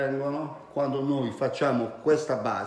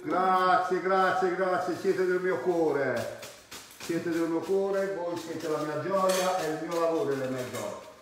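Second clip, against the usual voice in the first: excited; 5 semitones higher, 4 dB louder than usual. Real, not acted. Thanking viewers for greetings from abroad.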